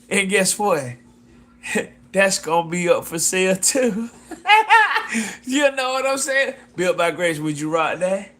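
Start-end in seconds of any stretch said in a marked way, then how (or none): 4.3-5.4 high burst of laughter. Real, not acted. Laughs at own pitch.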